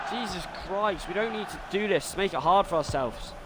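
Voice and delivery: normal talking voice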